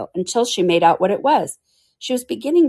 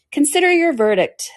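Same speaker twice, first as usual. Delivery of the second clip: king's voice; 9 semitones higher than usual. Acted. King addresses the jury.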